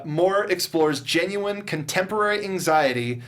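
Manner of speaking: usual speaking voice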